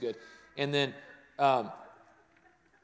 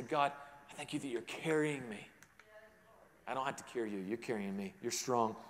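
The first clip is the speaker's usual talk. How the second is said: 1 soft prayer; 6 semitones lower, 10 dB quieter than usual. Acted.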